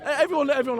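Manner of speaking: laughing. Real, not acted. Laughing denial about mornings.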